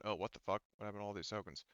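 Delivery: normal talking voice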